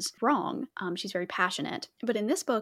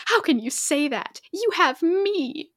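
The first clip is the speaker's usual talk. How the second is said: louder, higher, sharp reading of her line; higher, louder spat-out line; spat out angrily; 8 semitones higher, 6 dB louder than usual. A narrator's reading. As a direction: read it as a hurt, angry retort. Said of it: "she spat".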